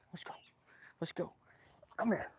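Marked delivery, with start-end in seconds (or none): 1.0-1.3 hushed whispered "Let's go"; quiet whispered call to go. Real, not acted. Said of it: "trying to whisper". 2.0-2.3 whispered call, pitched up. Real, not acted. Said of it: "trying to whisper".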